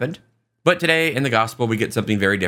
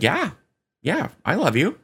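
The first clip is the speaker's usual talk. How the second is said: higher, rising yeah; lightly voiced reassurance, pitched up; acted eager assurance; 6 semitones higher than usual. Peter answering Jesus's question, higher-pitched mock assurance.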